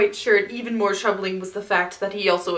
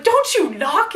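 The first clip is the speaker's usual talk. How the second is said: annoyed snap; 9 semitones higher, 7 dB louder than usual. Retold or imitated. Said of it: "Izzy's just like".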